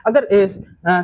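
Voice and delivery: normal talking voice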